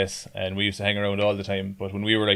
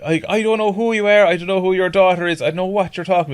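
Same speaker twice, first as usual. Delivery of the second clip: raised, insistent mock denial; lad denying; 9 semitones higher, 3 dB louder than usual. Lad gaslighting the drunk father. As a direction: deny everything, voice pitched up. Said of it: "it's like".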